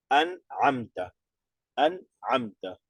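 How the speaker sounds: "An'amta" read slowly, the two words run together without a break; slow, connected reading of "An'amta"; tajweed demo. Shown as a demonstration. Teacher showing students how to link the words, slowly. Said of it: "Enseguida, ¿no?".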